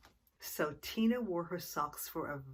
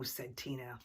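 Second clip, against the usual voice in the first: ordinary delivery, nothing marked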